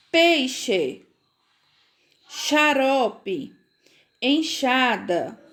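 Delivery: "peixe, xarope, enxada" as separate words, each x a clear sh; "peixe, xarope, enxada" spaced out, x said as sh; CH sound for X. Shown as a demonstration. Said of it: "estão ouvindo a pronúncia".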